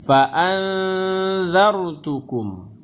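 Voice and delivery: fa anzartukum with a drawn nasal n; slow recitation. Shown as a demonstration. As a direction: recite anzartukum slowly, n hummed. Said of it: "jadi bacanya menjadi".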